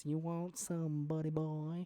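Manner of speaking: southern accent. Shown as a demonstration. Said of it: "you'll get a southern accent".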